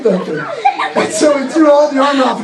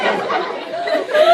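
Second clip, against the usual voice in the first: "clarify" sung out very high and very slow; silly high stretched clarify; much slower than usual. Shown as a demonstration. Teller mocking her own clarification in a high squeak. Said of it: "I want to clarify".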